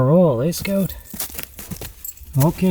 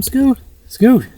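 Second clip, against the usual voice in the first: raised voice, higher, calling out to Scout; calling the dog; 8 semitones higher, 5 dB louder than usual. Real, not acted. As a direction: call across to the dog.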